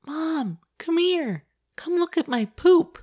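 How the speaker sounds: put-on high, drawn-out child voice; imitating a kid calling Mom. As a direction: call for Mom like a proud little kid. Voicing child summoning a parent.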